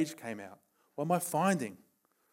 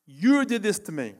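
loud, raised blame; accusing quote; 5 semitones higher, 7 dB louder than usual. Retold or imitated. Preacher voices Adam and Eve blaming God, accusing. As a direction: throw the accusation out, voice pitched up.

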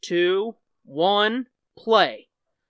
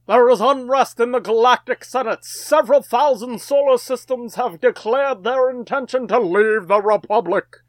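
higher, louder epic narrator read; movie trailer voice; 6 semitones higher, 5 dB louder than usual. Acted. Reads the opening crawl aloud.

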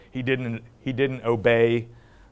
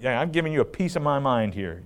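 ordinary talk, nothing marked

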